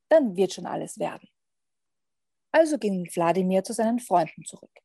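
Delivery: measured read-aloud with long pauses; lively picture-book narrator, pausing between phrases; story narration. A narrator's reading. Podcast guest reads a vampire picture book to listeners, storytelling.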